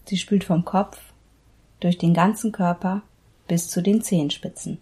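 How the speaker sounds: measured meditation guide with long pauses; unhurried Yoga Nidra instruction, pausing between body parts; guided meditation. Acted. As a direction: lead them into relaxation, phrase by phrase.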